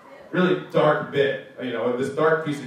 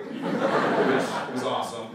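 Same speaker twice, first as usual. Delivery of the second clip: normal talking voice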